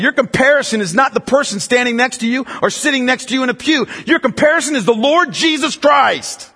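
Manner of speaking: high, impassioned exhortation; full-force preaching. Real, not acted. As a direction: drive the point into the congregation.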